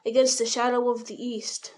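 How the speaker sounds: ordinary delivery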